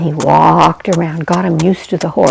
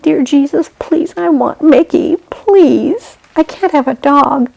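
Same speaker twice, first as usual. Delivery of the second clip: higher, pleading little prayer; child's prayer; 7 semitones higher than usual. Acted. Child prays for the chimp.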